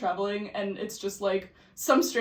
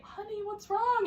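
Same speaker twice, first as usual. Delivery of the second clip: higher, slow, soft motherly voice; worried mom; 9 semitones higher, 5 dB quieter, slower than usual. Retold or imitated. Mom finding her daughter crying in the cathedral, soft and higher. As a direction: coax it out gently like a worried parent.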